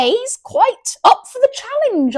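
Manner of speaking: high, loud posh voice; posh accent. Pretend. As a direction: put on a hammed-up posh accent. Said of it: "gone all posh here".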